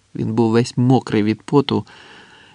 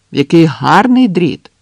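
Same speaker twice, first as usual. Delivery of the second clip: shouted praise; 5 semitones higher, 7 dB louder than usual. A narrator's reading. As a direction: holler exaggerated admiration. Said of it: "крикнув Андрес".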